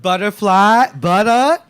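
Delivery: loud, high call with rising ends. Real, not acted. Host summons performers to the stage, calling out.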